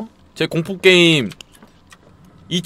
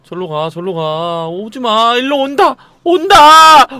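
rising alarm, louder as it nears; 2 semitones higher, 6 dB louder, slower than usual. Real, not acted. Gamer watching a monster approach, alarmed cries. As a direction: cry out as the monster comes at you.

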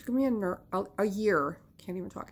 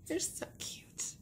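whispered aside; 10 dB quieter, slower than usual. Real, not acted. She whispers to the camera about her cute pets. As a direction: whisper it, no voice at all.

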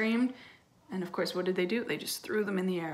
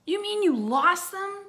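high, drawn-out disbelieving cry; loud, high and slow in shock; mock shout; 11 semitones higher, 7 dB louder than usual. A narrator's reading. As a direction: shout it like an appalled kid sister. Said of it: "shouted D W".

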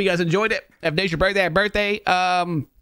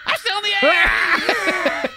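high, drawn-out wail with laughter; mock panic; much slower than usual. Voicing the rider still in the air, mock panic. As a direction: play a terrified rider, high and stretched.

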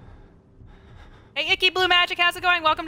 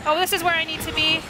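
usual speaking voice

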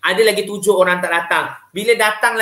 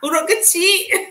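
mock answer; 13 semitones higher, slower than usual. Joking wrong answer, then laughs.

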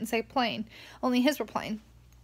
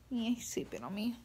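much softer, almost hushed so the puppy stays asleep; 13 dB quieter than usual. Real, not acted. Owner speaks softly over her sleeping puppy, hushed.

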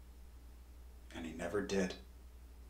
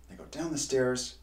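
ordinary delivery, nothing marked

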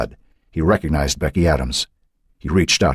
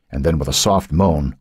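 ordinary delivery, nothing marked

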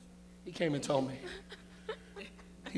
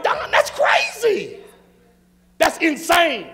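outraged shout; 10 semitones higher, 11 dB louder, slower than usual. Real, not acted. Pastor erupts at the absurdity.

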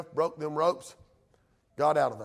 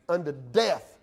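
ordinary delivery

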